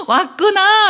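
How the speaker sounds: high, loud, tearful welcome home; glad welcome. Shown as a demonstration. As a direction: greet the child with overjoyed relief. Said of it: "이렇게 환영해 주시면 돼요".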